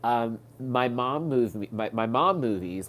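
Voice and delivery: usual speaking voice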